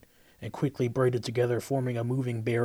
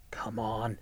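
strained whisper; 2 semitones lower, 7 dB quieter than usual. Acted. Kelsa straining to free herself.

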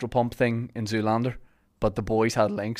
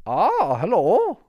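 high, sing-song royal prince; 9 semitones higher, 3 dB louder, much slower than usual. Pretend. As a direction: play a young prince about to be crowned. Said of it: "Something like that".

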